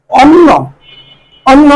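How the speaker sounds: অন্ন with a held double n; model pronunciation. Shown as a demonstration. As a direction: present অন্ন alone as "on-no". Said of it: "উচ্চারণ হয়". (অন্ন আলাদা করে স্পষ্ট উচ্চারণ)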